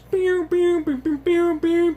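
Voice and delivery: very high pew pew; high pew sounds. Retold or imitated. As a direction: squeak it like tiny lasers. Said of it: "they're like".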